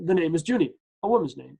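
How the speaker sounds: usual speaking voice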